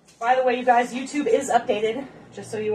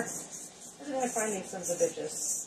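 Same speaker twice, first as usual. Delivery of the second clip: muttered aside; 3 semitones lower, 11 dB quieter, slower than usual. Real, not acted. Muttering to herself mid-recipe.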